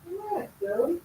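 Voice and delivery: high, sing-song voice for the bird; pet talk. Real, not acted. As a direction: coo at your little pet.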